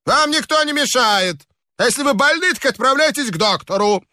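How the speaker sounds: high, mocking retort; a reveller snapping back at the guest; rude neighbour. A narrator's reading. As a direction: brush off the complainer. Neighbour answers rudely.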